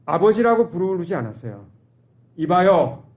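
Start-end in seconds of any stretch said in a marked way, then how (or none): 2.3-3.0 a curt, louder "이봐요" to his father; disrespectful son addressing father; rude call to father. Retold or imitated. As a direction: call him out without respect. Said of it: "이렇게 부른 겁니다".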